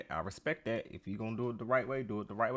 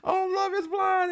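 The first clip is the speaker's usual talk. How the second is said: high, loud excited squeal; mock fan gushing, high-pitched; 8 dB louder than usual. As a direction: gush like a delighted fan. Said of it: "imagine me being like".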